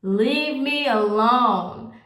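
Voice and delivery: drawn-out, slow "leave me alone"; slow, weighted "leave me alone"; slow stern warning. Shown as a demonstration. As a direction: stretch each word like a final warning. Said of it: "when I'm telling you to leave me alone".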